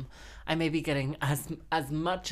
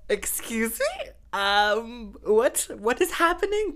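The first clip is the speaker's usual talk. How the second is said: high, rising squeals of surprise; shocked disbelief; 8 semitones higher, 6 dB louder, slower than usual. Retold or imitated. Host recalling finding out she was on radio, squealing in disbelief. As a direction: play total shock at being on the radio. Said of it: "And I was like".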